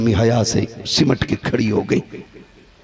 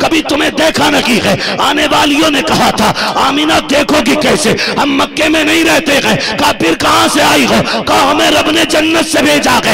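loud, high impassioned sermon chant; full-force preaching; 12 semitones higher, 6 dB louder than usual. Acted. (पूरे जोश में ऊँचा उपदेशी स्वर)

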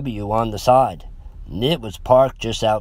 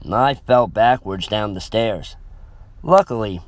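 usual speaking voice

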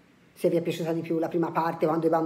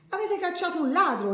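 high-pitched story astonishment; amazed exclamation; 11 semitones higher, 3 dB quieter than usual. A narrator's reading. Signora Ciambella learns the thief is gone, amazed exclamation. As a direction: exclaim it high, falling at the end. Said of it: "esclamò la signora Ciambella".

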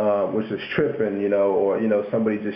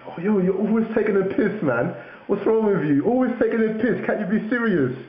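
high-pitched mock indignant Londoner; London slang; 10 semitones higher than usual. Protesting at being made fun of, mock London accent. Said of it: "They'll be like".

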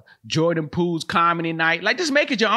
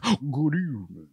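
mock-sinister host's greeting, softer; playful impression of a droll macabre presenter; Alfred Hitchcock; 5 dB quieter than usual. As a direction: greet them like an old suspense-show host. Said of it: "like Alfred Hitchcock".

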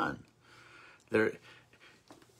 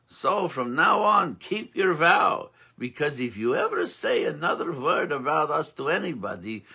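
put-on storyteller's Yiddish accent; 2 semitones higher than usual. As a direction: spin the old rebbe legend in a gentle Yiddish lilt. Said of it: "it's fun to do the accent".

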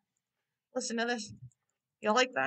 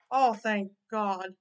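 ordinary talk, nothing marked

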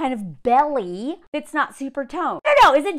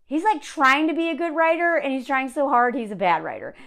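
normal talking voice

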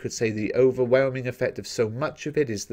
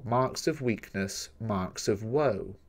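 slow, measured reading of the line, each syllable placed; slow poem reading; 5 dB quieter, slower than usual. Shown as a demonstration. Teacher reading a line of the poem aloud to students, slow and measured. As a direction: read it out slowly so every beat can be counted. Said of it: "line four".

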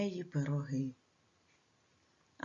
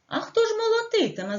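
high, quick rooster's question; rooster asking; 12 semitones higher, 3 dB louder, faster than usual. Acted. Rooster questions the lazy mice, high and quick. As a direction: pipe up as the busy rooster. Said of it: "запитав півник".

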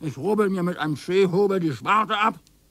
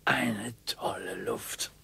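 slow whisper; 7 semitones lower, 7 dB quieter, slower than usual. Acted. Husband savours countryside air.

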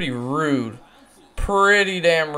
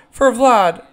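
excited yelling; 8 semitones higher, 4 dB louder than usual. Real, not acted. Excited as ball carries.

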